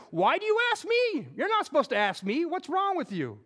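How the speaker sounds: high, pressing retort with an edge; high-pitched indignant questioning; indignant Jesus. Acted. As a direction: challenge them, incredulous.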